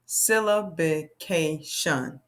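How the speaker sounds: "Syllabication" said slowly in separated syllables. Shown as a demonstration. Teacher repeats the big word for young readers, "Syllabication" syllable by syllable. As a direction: break "Syllabication" into its five syllables. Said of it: "I'm gonna say it again".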